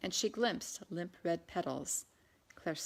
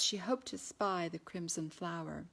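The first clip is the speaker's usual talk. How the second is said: usual speaking voice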